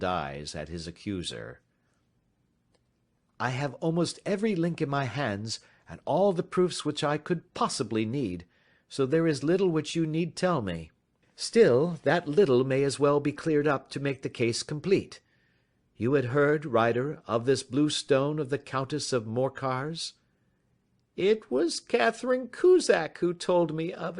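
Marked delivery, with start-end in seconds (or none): 3.4-20.1 lightly voiced, slightly higher, courteous detective; polite and in command; composed detective. A narrator's reading. Detective inviting the stranger to talk, lightly voiced calm. 21.1-24.2 higher, strained voice near breaking; barely holding together; cracking voice. A narrator's reading. Frightened clerk confessing to the detective, cracking voice. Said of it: "he said in a cracking voice".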